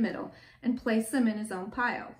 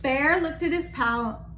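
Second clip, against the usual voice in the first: usual speaking voice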